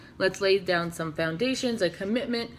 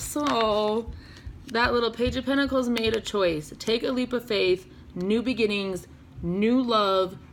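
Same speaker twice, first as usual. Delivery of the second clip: ordinary delivery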